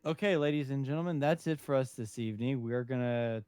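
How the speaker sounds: loud, emphatic announcer wrap-up; mock sign-off. Co-host pretends to end the show, mock announcer.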